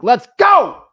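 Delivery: loud, high hype shout; excited cheer. Real, not acted. Coach cheers Devin's sticky-note tally, excited shout. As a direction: yell it like a pumped-up coach.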